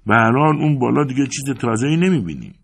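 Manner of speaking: high, loud complaint; grumbling protest. A narrator's reading.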